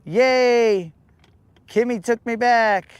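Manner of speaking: hammed-up happy yay; mock cheer. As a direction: imitate a lovesick guy celebrating. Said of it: "he's gonna be all like".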